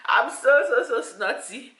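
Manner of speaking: ordinary talk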